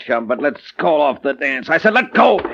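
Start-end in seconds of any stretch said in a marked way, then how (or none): 1.7-2.4 sharp command. Acted. Client grabs detective.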